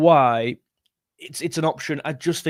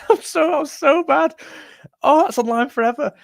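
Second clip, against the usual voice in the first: high, laughing self-mockery; embarrassed laughing; 12 semitones higher, 3 dB louder than usual. Real, not acted. Streamer after botching an accent live, embarrassed high laughter. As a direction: laugh helplessly at yourself, voice shooting up high.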